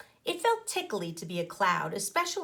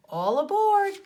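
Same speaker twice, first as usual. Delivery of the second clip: high, loud call; 11 semitones higher, 8 dB louder than usual. A narrator's reading. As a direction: call them onto the bus. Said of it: "called the Frizz from the bus".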